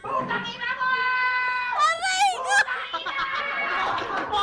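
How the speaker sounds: high, loud cursing through a laugh; laughing scared shout. Real, not acted. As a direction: yell the curse, half scared and half laughing. (sigaw na may tawa sa gulat)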